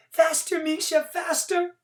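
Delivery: put-on high, eager child's cry; girl riding behind Misha on the carousel, excited; excited urging. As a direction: urge the horse on, giggly and eager. Girl urging on pretend horse.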